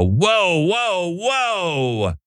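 high, drawn-out mock alarm; mock-scandalized, sliding down from high; objecting conservative type. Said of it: "as a conservative sola scriptura type, go".

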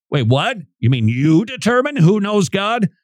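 astonished questioning, pitch jumping up; incredulous. Acted. Stunned listener questioning the rabbi's claim, incredulous. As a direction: challenge the claim in disbelief.

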